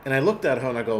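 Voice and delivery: ordinary delivery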